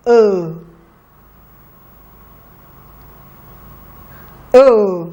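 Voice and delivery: short "uh." held alone and clear; dictated sound. Shown as a demonstration. Teacher dictates a sound for the children to write. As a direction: say "uh." as a clean isolated vowel. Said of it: "please write".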